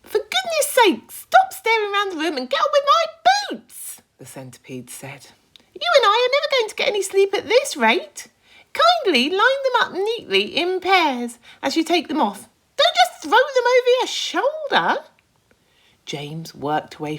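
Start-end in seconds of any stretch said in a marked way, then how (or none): 0.0-4.0 high, loud fussy creature voice; a bossy high-pitched centipede nagging. Acted. Centipede fussing at James over his boots, high and loud. 5.7-15.0 high, loud fussy creature voice; a bossy high-pitched centipede nagging. Acted. Centipede fussing at James over his boots, high and loud.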